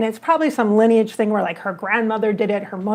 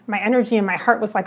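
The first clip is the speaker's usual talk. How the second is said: ordinary talk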